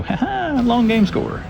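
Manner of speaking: high laughing delight; laughing excitedly. Real, not acted. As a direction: giggle out the win. Excited find of cheap pin set.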